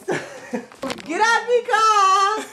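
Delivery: high drawn-out yell; playful yell. Pretend. Vlogger hollers at a car to get off, playful yell.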